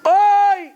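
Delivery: sudden high loud "oi" of pain; slapped man yelping in pain; mock pain cry. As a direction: yelp as if just slapped. Man cries out after smack.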